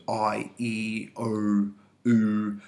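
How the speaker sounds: "I", "E", "O", "U" each held as a separate long vowel. Shown as a demonstration. Teacher listing vowels likely to be stressed, "I", "E", "O", "U" said long. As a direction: draw out "I", "E", "O", "U" one by one. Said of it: "these longer vowels".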